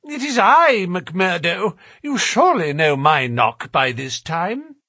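high-pitched gentleman announcing himself; fussy gentleman. Acted. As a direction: call out high and insistent to the porter. Voices Thaddeus Sholto answering.

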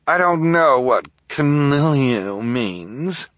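high, slow, snooty society lady; haughty socialite. Acted. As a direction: look down your nose, drawl it. Esme's haughty voice.

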